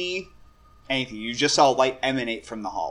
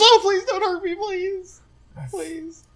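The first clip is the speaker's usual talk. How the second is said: high-pitched terrified whimpering plea; crying, scared man; slower than usual. Acted. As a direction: beg in a hammed-up sob, fading out. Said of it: "a man crying, shaking".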